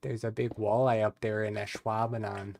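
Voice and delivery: a light, hammed-up Upper Midwest accent; mock Wisconsin accent. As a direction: play a folksy Wisconsin local, half-joking. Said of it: "made fun of my accent".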